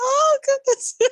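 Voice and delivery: overwhelmed by a sweet moment; moved gasp. Retold or imitated. Podcaster recalling a touching scene, high touched gasp.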